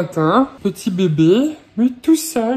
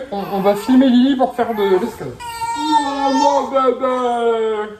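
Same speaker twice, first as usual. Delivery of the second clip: tearful; 2 semitones higher than usual. Real, not acted. Parent overwhelmed by baby, tearfully. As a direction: let the emotion break through.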